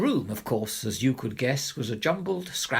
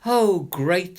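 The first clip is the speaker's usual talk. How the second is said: sarcastic; 4 semitones higher, 7 dB louder than usual. Acted. Parents' sarcastic reaction.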